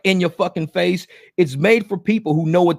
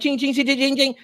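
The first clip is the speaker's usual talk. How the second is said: high, light ching-ching like loose change; mock the clink of spare change in your palm; tinkly coin-drop imitation; jingling coin noise; 8 semitones higher than usual. Mimicking coins dropping in hand.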